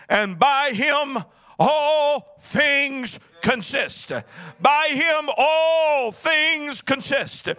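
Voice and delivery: fervent sung preaching cadence over the organ; full-force preaching. Acted. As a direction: preach it, half-singing each line. Preaching the scripture reading.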